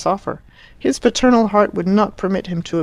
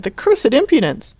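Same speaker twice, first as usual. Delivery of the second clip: high, loud indignant cry; shouted outrage; 7 semitones higher, 4 dB louder than usual. A narrator's reading. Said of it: "she shouted".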